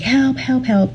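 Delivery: high, thin mock call for help from the wreck; retold cry for help.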